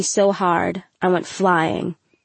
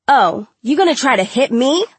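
higher-pitched, menacing challenge; confrontational, rising mock question; taunting bully; 5 semitones higher, 4 dB louder than usual. A narrator's reading. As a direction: taunt your victim, pitch climbing at the end. Bully taunting victim in fight.

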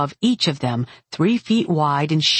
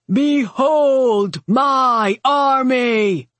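slow, higher war-leader's proclamation; rolling battlefield voice; 7 semitones higher, 4 dB louder, much slower than usual. A narrator's reading. Warlord displaying the army to Atlanta's defenders, rolling proclamation. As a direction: roll the words out grandly across a battlefield. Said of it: "Naeg's voice rolled through the battlefield".

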